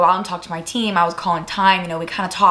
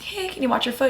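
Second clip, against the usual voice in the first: soft, slightly raised polite aside; imitated hushed request; 4 semitones higher, 6 dB quieter than usual. Catcher telling the umpire about the foot, hushed. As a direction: slip it in quietly. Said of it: "I was, like, whispering to the umpire".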